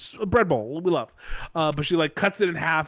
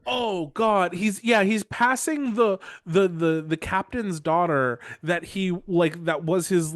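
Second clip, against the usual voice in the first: ordinary delivery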